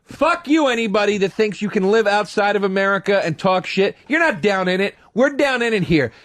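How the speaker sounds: high, worked-up outburst; heated rant. Real, not acted. Comedian ranting about foreigners criticizing America, high and heated. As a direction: go off in defiance.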